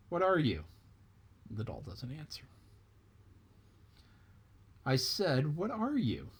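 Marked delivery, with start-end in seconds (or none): 0.1-0.6 rabbit's question. Acted. Rabbit questions silent tar doll. 4.8-6.3 rabbit's voice pitched up, pushy; impatient rabbit. Acted. Rabbit to silent tar doll, repeating with impatience.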